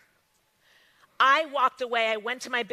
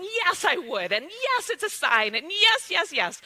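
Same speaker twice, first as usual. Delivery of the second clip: each yes higher and more thrilled, rising to louder; building excited yeses; 5 semitones higher, 3 dB louder than usual. Retold or imitated. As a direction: stack up the yeses with growing glee. Ecstatic yes to the offer.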